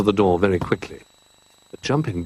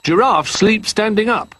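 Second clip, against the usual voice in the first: high, cheerful character voice; 11 semitones higher than usual. A narrator's reading. Jolly offers Little Bear his house, cheerful. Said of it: "said Jolly cheerfully".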